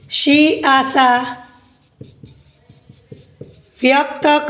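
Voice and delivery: word by word with long pauses; slow dictation. Acted. Teacher dictating an answer to students, slow dictation. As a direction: dictate slowly, leaving time to write.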